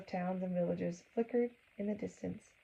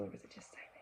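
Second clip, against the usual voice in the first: hushed whispered question; breathy storytime hush; 13 dB quieter, much faster than usual. Real, not acted. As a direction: whisper it like the silent train.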